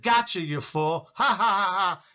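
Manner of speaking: imitating a laughing demon; demonic laughing voice. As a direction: gloat like a demon, then cackle. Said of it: "He's laughing in his demonic voice".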